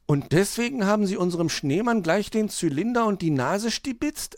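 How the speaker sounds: put-on high child voice, reproachful question; disappointed. A narrator's reading. Hedgehog asks magpie about robbed snowman, disappointed. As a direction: ask as a let-down little hedgehog. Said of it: "erkundigte sich Borstel enttäuscht".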